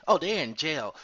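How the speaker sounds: loud mock viewer heckle; heckling viewer. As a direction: blurt it out like a gossip. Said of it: "imagined viewer comment".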